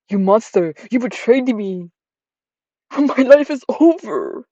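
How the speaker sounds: hammed-up heartbroken accusation; betrayed girlfriend. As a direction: melodramatic shrieking ex.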